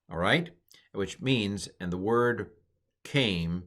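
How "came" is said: ordinary delivery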